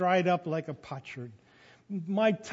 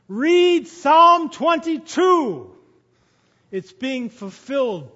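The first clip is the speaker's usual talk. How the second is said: slow, higher, shouted command; loud call; 6 semitones higher, 11 dB louder, slower than usual. Acted. Jesus calling loudly to disciples, slow shout. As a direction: call it out slowly to those afar. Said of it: "He's calling to his disciples".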